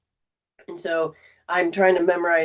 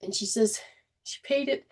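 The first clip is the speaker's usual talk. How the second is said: ordinary talk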